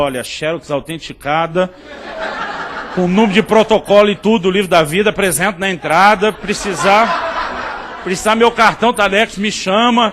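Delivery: loud, hammed-up heavenly messenger; projected comic angel pitch; mock angel. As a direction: announce the paperwork loudly, as a comic angel. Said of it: "Um anjo, talvez, veio pra você, falou".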